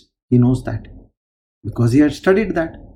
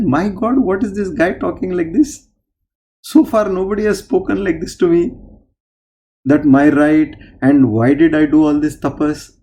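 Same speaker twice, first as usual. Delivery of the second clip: higher, incredulous exclamation; shocked Lord; 5 semitones higher, 3 dB louder than usual. Acted. Lord shocked by the sadhu's demand, incredulous. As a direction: sound stunned at the rudeness. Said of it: "this shocked that Lord".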